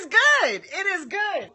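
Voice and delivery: "good" bursts out high and loud, then settles; a sudden delighted cry over the food; excited outburst. Real, not acted. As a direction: blurt out how good it is. First bite of the salad.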